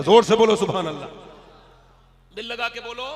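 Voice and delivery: rally the crowd to shout back; hindi political rally speech. Real, not acted. Calling on crowd to respond.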